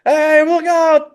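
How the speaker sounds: high, loud, drawn-out warning; shouted warning. Acted. Rickshaw driver bearing down on Po, shouted warning. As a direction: yell to clear the road.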